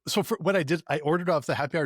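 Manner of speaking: usual speaking voice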